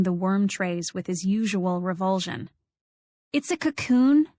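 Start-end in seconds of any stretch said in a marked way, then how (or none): 3.3-4.3 announcing it like a birth; proudly, pitched up, a new mother's news; proud announcement. A narrator's reading. Narrator announcing the cocoon proudly to Robbie. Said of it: "in the same voice you might have used to say".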